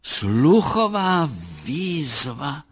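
slow, high-pitched clinical marvelling; drawn-out remark rising into wonder; fascinated psychologist. A narrator's reading. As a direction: observe it with acted fascination. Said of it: "poznamenal pan Kse".

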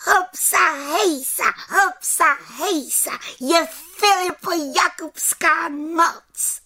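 very high little witch whooping; rejoicing little witch; jubilant cheering. Acted. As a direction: exult in a high, bouncing chant. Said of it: "jásala malá čarodějnice".

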